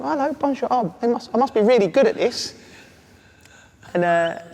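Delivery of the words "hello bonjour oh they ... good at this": high, pleased with himself; a clueless newcomer feeling clever; smug naive pride. Retold or imitated. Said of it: "And I'm going, I think I'm".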